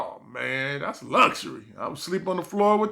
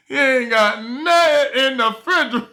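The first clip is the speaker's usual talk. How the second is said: higher, louder repeat of the complaint; mock disbelief; 8 semitones higher, 5 dB louder than usual. Ex girlfriend checking his empty fridge, louder and disgusted. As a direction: say it again with more outrage. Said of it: "looked in my refrigerator like".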